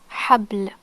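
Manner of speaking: "Habl" with b straight into l; no vowel after b. Shown as a demonstration. Teacher repeating the sukoon rule, "Habl" without vowel after b. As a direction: end "Habl" on a bare bl cluster. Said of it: "no vowels after ba".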